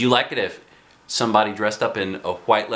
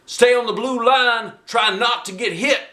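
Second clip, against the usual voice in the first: coach shout; 12 semitones higher, 3 dB louder than usual. Acted. Teacher shouting instructions.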